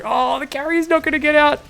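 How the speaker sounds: high-pitched mock panic; imagined player's despair. Commentator imagines the player watching his carrier trapped, high mock despair. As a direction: wail as the losing player. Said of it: "What, what other words do we think are going through?".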